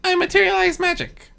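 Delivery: playful high trickster voice; lightly voiced trickster; high Discord voice. A narrator's reading.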